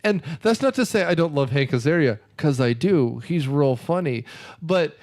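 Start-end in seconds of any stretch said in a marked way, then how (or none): none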